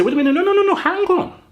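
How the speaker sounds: hammed-up defiant protest; defiant outburst. Mock manager voicing players standing up, defiant. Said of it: "stand up and say".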